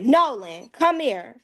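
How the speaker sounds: stern call. Retold or imitated. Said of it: "my voice in a certain tone".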